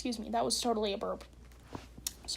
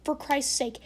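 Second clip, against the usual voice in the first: ordinary delivery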